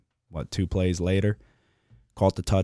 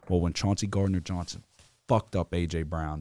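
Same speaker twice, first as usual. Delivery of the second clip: normal talking voice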